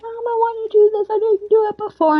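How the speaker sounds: put-on high pleading child voice; begging mom like a little boy; whiny kid. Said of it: "And he's like".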